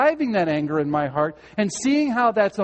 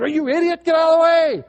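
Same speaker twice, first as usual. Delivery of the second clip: higher, louder shout at a driver; mock shout; 11 semitones higher, 8 dB louder than usual. Driver yelling at a car blocking the lane, loud shout. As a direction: yell it through the windshield. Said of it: "say things out loud to that person".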